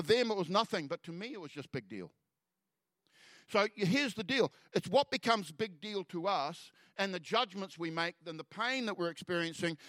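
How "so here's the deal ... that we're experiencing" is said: slow, raised preaching point; preacher underlining the key point; preaching emphasis. Acted. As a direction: lean in and slow down for the lesson. Preacher drives home point.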